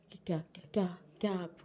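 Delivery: stammered whisper of alarm; breathless whispered stammer; nervous whisper. Acted. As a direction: whisper the stutter as if caught out. Stammering husband, caught out.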